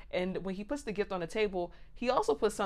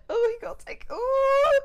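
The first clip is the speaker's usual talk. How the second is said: very high, loud excited squeal; giddy anticipation; 5 dB louder than usual. Retold or imitated. Excited anticipation of her date coming.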